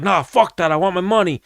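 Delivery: high mock angry refusal; hammed-up angry fighter wanting money; imitating a fighter refusing to calm down; stubborn refusal.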